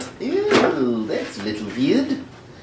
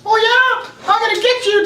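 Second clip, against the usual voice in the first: very high falsetto toy fighter; playful toy voice. Pretend. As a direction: squeal a mock threat in falsetto. Voicing toys in a fight.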